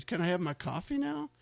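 a wife at the end of her patience over coffee; exasperated plea. Retold or imitated. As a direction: squeak it out, fed up and impatient. Wife asks again, exasperated.

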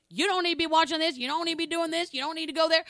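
loud, fast, scolding rules; mock-strict preacher laying down the law; hardcore bossy. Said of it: "I was like".

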